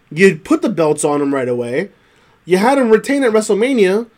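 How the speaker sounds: normal talking voice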